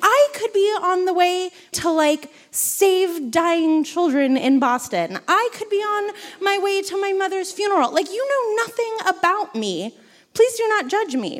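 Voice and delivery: indignant inner rant, pitched high; seething. Retold or imitated. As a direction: protest your innocence in mock indignation. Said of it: "I'm sitting there seething".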